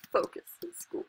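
put-on a breathy whispered aside to the kids. Gamer whispers mock advice to the kids, softly. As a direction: lean in and whisper it like a secret.

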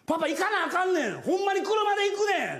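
pleading at full voice with her; exasperated dad shouting at the kid; retold frustrated shout. Father yelling at toddler.

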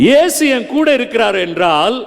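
ordinary delivery

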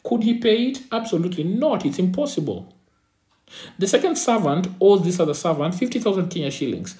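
ordinary talk

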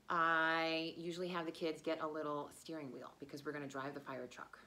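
ordinary delivery

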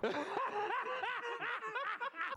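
long high-pitched laugh at his own bad luck. Real, not acted. Streamer laughing at his awful luck, high and drawn out. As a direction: let out a helpless laugh.

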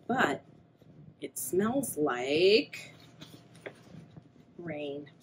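slow suspense. Acted.

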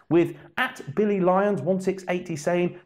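ordinary talk

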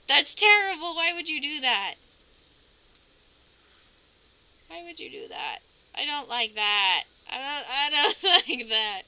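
high, squirming protest with nervous laughter; creeped out. Real, not acted. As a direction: protest as if you can't bear to look. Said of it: "that is scary".

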